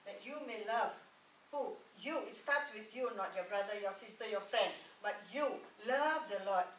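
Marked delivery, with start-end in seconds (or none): none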